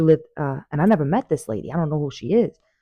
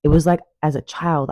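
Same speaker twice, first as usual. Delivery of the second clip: ordinary talk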